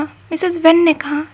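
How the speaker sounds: ordinary delivery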